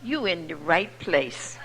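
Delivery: soft, slow reassuring welcome; gentle welcome. Retold or imitated. Doorman soothes the frightened newcomer, soft and slow. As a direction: ease her into the chair gently. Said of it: "He said".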